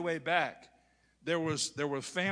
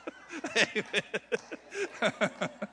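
real laugh at own joke; laughing after joke; high laugh; 6 semitones higher than usual. Real, not acted. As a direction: laugh it off, amused.

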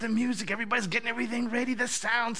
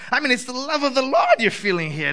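ordinary delivery

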